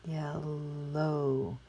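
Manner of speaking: "Yellow" stretched slowly with a long L; drawn-out word. Shown as a demonstration. Teacher showing O W says O, "Yellow" drawn out. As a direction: blend "Yellow" slowly into a held O. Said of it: "use the O W to say O".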